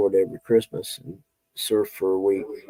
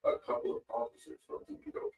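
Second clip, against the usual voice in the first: distant muffled tv dialogue; tv through the wall; 16 dB quieter than usual. Real, not acted. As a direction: background television bleeding in. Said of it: "Sorry about the T V noise in the background".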